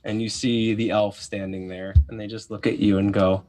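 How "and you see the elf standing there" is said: usual speaking voice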